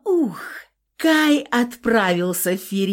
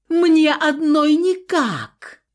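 loud high plea; 7 semitones higher, 4 dB louder, slower than usual. A narrator's reading. Still calling for help.

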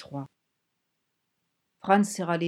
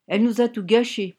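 loud, higher-pitched whine; whining; 4 semitones higher, 7 dB louder than usual. A narrator's reading. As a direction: complain like a sulky whiner. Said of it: "pleurnicha quelqu'un à sa droite". (ton pleurnichard, aigu et fort)

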